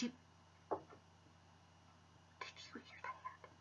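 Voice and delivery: hushed whisper. Real, not acted. Vlogger whispering as she hears a noise.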